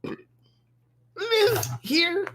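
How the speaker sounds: high-pitched, shaky goblin voice; startled goblin. Acted. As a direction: squeak it out slowly like a startled goblin. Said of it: "startled voice".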